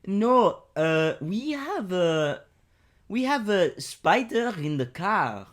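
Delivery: put-on slow, high French accent; exaggerated French accent, drawn out and high. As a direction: play a halting French motorist. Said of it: "I'll be French".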